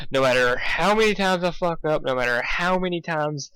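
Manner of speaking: ordinary delivery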